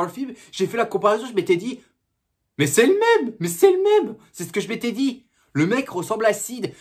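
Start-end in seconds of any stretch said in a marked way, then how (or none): 2.6-4.2 high, loud amazed exclaim; retelling his own amazed cry; excited exclaim. Retold or imitated. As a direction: burst out at the likeness. Recalls spotting the lookalike.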